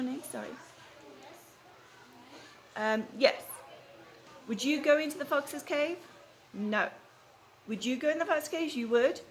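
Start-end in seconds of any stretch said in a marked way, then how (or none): none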